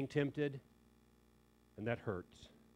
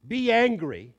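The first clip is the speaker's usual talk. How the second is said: loud, higher, punched command; forceful command; 7 semitones higher, 10 dB louder than usual. Acted. Preacher reading Ephesians' command to the church, forceful emphasis. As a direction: bark it as an order.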